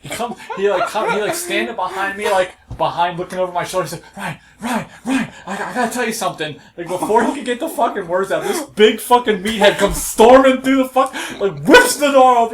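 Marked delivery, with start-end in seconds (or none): 4.2-6.6 higher, fast retold panic; panicked urgency. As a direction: imitate a flustered man blurting for help. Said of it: "fucking panicking from behind me".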